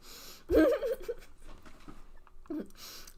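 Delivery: breathy embarrassed giggle; laugh. Real, not acted. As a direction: laugh it off sheepishly. Laughs after biscuit confession.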